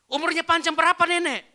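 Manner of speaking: high, very loud question to granny; acted full-volume asking; shouted question. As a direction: shout it so the old woman hears. Shouting question to very old woman.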